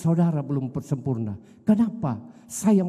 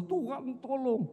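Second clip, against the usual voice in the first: high, hushed, slow desperate plea; a hushed, aching cry for help, pitched up; crying out to God; 7 semitones higher, 9 dB quieter, slower than usual. Retold or imitated.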